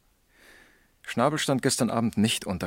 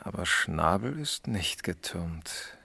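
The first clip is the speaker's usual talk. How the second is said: slow, whispered objection; hushed, slow counterpoint; 3 semitones lower, 6 dB quieter, slower than usual. Acted. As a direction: whisper it carefully, weighing each word. Quiet objection while reasoning.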